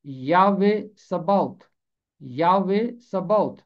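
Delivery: یاوے سباوت slowed as ya-weh sa-ba-ot; slow Hebrew pronunciation. Shown as a demonstration. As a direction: say یاوے سباوت slowly and clearly. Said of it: "عبرانی میں ہے".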